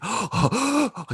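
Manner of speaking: sharp, high acted gasps; gasping for breath. Storyteller imitating a sobbing man on a park bench, gasping for breath. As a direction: mimic a sobbing man heaving for air. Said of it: "trying to gather his breath".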